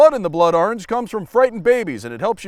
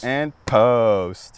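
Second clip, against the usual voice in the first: sleepy catchphrase; 8 semitones lower than usual. Acted.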